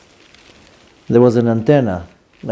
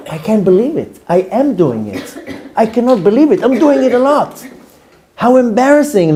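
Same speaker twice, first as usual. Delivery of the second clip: higher-pitched exasperated self-talk; embarrassed disbelief; 7 semitones higher than usual. Retold or imitated. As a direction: replay being mortified at yourself, pitch climbing. Acting out his embarrassed thoughts.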